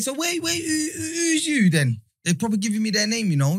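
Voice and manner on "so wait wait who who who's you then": high, slow, drawn-out mock query; mock suspicious questioning. Retelling the police interview, slow high mock questioning. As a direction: play dumb and stretch every word up high. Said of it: "I'm saying".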